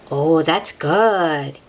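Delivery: usual speaking voice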